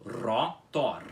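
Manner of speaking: "Rotor" with trilled Rs; pronunciation demo. Shown as a demonstration. Teacher closing the drill on the word. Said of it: "com os dois R's enrolados".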